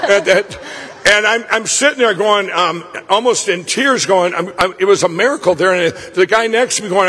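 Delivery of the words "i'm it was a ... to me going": ordinary talk, nothing marked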